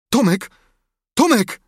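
high, loud alarmed call. A narrator's reading. Sid calling his groaning brother, shouting. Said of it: "zawołał Sid".

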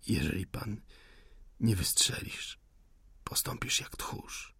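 soft, fading whisper; weak whispered challenge; whispered taunt. Acted. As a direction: hiss the insult almost silently. Wounded man taunts the officer.

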